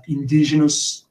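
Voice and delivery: ordinary talk, nothing marked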